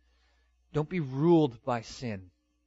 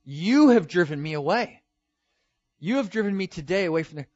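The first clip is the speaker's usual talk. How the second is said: loud, higher-pitched mock accusation; accusing; 5 semitones higher, 9 dB louder than usual. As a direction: hurl the blame, hammed-up and indignant. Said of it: "he brings accusation against God".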